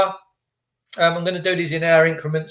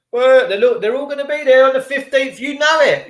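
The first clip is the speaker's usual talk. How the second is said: high, raised voice of everyone else; mock excited crowd; 10 semitones higher, 4 dB louder than usual. Everyone else talking about the fifteenth, mock excited. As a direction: pitch it up as the eager crowd. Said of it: "Everyone else is going".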